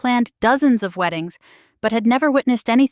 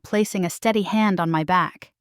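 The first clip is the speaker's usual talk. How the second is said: plain reading voice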